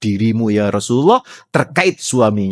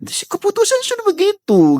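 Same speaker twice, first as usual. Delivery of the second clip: much higher, clipped verdict voice; mock firm reply. Preacher as the Prophet answers the wife, high and final. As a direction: shut the plea down with a high voice.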